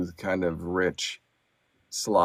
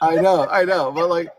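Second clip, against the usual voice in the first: laughing; 8 semitones higher, 8 dB louder than usual. Real, not acted. Laughs at being called cheap.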